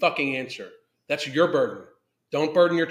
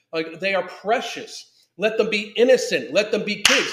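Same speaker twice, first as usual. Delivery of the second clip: raised, louder, indignant pleading; impassioned plea; 3 semitones higher, 4 dB louder than usual. Real, not acted. Pleading with adults to spare children, impassioned and indignant.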